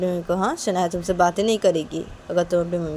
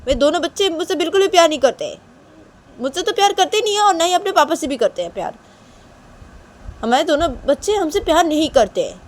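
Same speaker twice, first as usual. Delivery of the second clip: higher, louder, insistent mother; angry scolding mother; 8 semitones higher, 9 dB louder than usual. Acted. Mother silencing Shanaya, loud and angry. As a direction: scold as a mother who feels unloved.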